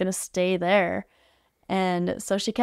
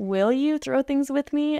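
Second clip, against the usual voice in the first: higher and slower, an inviting ask; gentle coaxing invitation; 6 semitones higher than usual. Retold or imitated. To the girl who won't throw, gently coaxing.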